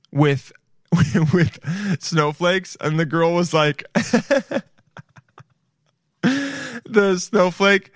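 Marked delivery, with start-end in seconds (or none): 3.9-4.6 high burst of giggles; amused high-pitched laugh. Real, not acted. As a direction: crack up helplessly. Cracks up at snowflake story.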